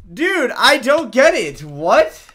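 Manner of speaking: high, loud, drawn-out bafflement; baffled exasperation. Real, not acted. Gamer stumped by the level, baffled outcry. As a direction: cry out slowly in confusion.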